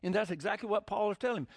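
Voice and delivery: ordinary delivery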